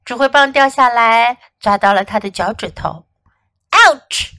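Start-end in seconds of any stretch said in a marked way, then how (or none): 3.7-4.3 high, loud cry of pain; yelling. A narrator's reading. Fox after dropping baton on toe, yelling. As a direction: yelp from a hurt toe. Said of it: "he yelled".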